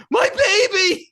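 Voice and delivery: high, loud, drawn-out mock wail; hammed-up cry of a distraught mother. Mother over her brain-damaged baby, mock wailing.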